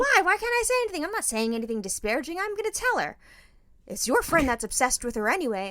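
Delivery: high, pleading father-in-law; mock indignant old man; whiny protest. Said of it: "And Jeff goes".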